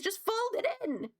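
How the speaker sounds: high, exasperated quoted line; Schitt's Creek quote. Retold or imitated. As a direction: toss it off like a fed-up sitcom sibling. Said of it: "She did such a good job as Moira".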